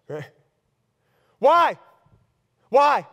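ordinary delivery

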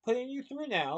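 high, assured switchboard voice; high-pitched, confident operator. A narrator's reading. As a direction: connect the call with breezy confidence. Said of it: "Lottie said confidently".

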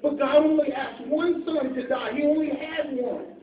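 loud, rising sermon voice; full-force preaching. Acted. Preacher pleading with the congregation, loud and driving. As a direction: preach it hard to the pews.

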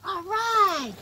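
high excited exclamation; excited all right. Real, not acted. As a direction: cheer the dogs on. Excited at dogs playing.

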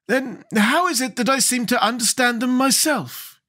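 high-pitched, questioning Scarecrow king; doubting Scarecrow. Acted. Scarecrow tests his interpreter, high and doubting. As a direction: question closely in a high character voice.

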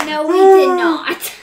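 loud, firm denial. Real, not acted. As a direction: push back loudly. Denying the scale check.

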